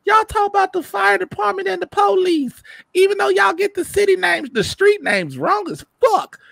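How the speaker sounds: high falsetto rant; mock Chicagoan verdict. Former Chicago resident judging the TV shows, in mock high-pitched critique. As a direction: squeak out grudging approval turning to complaint.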